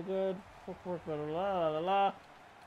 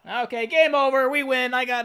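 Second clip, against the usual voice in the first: higher, louder victory call; happy cheer; 5 semitones higher, 7 dB louder than usual. Real, not acted. Gamer announcing the win, happy and louder. Winning the game.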